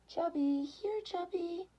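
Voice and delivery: very high, slow coaxing call; high, drawn-out kitty call; calling the cat. A narrator's reading. Karen calling for lost cat.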